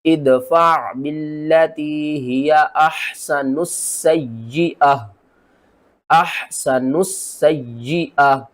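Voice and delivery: slow tajweed chant, doubled seen and ya; Quran recitation. Shown as a demonstration. Teacher recites the verse to show the stop, slow chant. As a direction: recite slowly, stopping on the ha. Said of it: "ता को पढ़ना है या हा को पढ़ना है".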